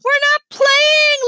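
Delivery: high, loud, drawn-out protest; slow shrieked objection; screaming. A narrator's reading. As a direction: scream it indignantly, stretching the words. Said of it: "Libby screamed".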